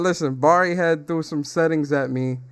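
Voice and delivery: ordinary talk, nothing marked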